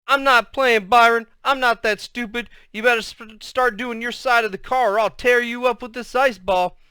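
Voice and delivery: put-on irritated kid making loud threats; annoyed threat. A narrator's reading. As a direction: warn your brother you mean it. Boy threatens his brother in quarrel.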